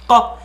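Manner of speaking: ko from the back of the throat. Shown as a demonstration. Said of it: "In that cave lives the sound ko".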